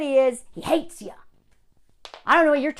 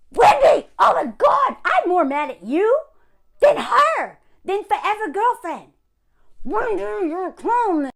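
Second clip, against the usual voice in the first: loud, higher, exasperated yelling at the columnist; outraged shout; 8 semitones higher, 7 dB louder than usual. Real, not acted. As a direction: really let her have it.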